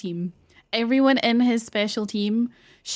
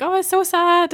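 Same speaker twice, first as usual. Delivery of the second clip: mock pity; 9 semitones higher, 6 dB louder than usual. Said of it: "and it's like".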